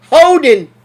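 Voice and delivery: "Holding" called high and loud as "hoden"; referee's holding. Retold or imitated. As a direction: belt out "Holding" dropping the L, ref style. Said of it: "It's H O D E N".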